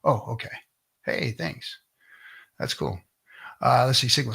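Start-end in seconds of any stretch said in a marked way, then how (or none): none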